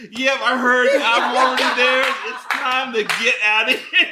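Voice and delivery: high, half-laughing readiness; high eager reply. Retold or imitated. Friend already walking over to leave, high and eager. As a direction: answer high and instantly ready to flee.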